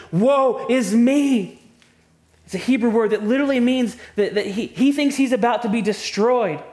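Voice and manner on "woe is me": very slow, much higher and louder anguished cry; agonizing cry. Retold or imitated. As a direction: wail it like a man about to be destroyed. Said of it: "terrible, agonizing".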